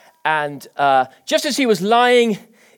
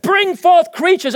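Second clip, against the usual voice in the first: commanding God; 11 semitones higher, 5 dB louder, slower than usual. Acted.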